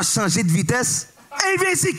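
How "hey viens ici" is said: high mock cop call. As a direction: yell after someone, hammed-up. Imitating bike cop calling. (le policier à vélo crie, très aigu)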